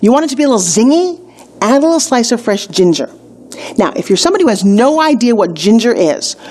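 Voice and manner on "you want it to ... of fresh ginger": normal talking voice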